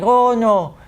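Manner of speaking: high, loud fretting servant; Irish-ish butler squeaking oh no; panicked butler. Acted. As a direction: flap like a frightened butler. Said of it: "It's like".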